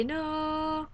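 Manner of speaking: "no" held long on a high, steady note; drawn-out no. Shown as a demonstration. Teacher jokingly showing how to say no, drawn out high. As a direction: stretch "no" out high and soft, like a held tone. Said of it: "instead of saying no, you say".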